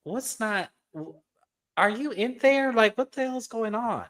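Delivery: high-pitched incredulous plea; exasperated disbelief. Real, not acted. Host asking Monique if she's thinking, in high-pitched disbelief.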